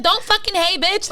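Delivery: high, loud retold snap at a rival; mad. Woman to the other girl on speakerphone, mad. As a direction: re-enact an angry comeback. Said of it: "Like, I was mad".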